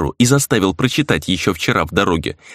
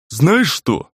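higher, exasperated exclamation; exclaiming; 6 semitones higher than usual. A narrator's reading. Grizzly close to losing his temper, exclaiming. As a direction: burst out, barely holding back. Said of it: "воскликнул Гризли".

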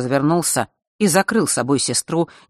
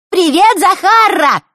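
high squeaky mocking girl voice; 8 dB louder, slower than usual. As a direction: squeak it to mock her. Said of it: "начал он песклявым голосом". (писклявый дразнящий голос)

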